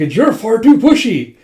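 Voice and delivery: high, loud acted complaint; exasperated story heroine; indignant retort. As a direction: throw it back indignantly. Girl snaps back at pushy man.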